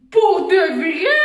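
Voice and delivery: loud, slow amazed question; can't believe the news; a slow, astonished "really?"; surprised. Pretend. Pretend phone call news.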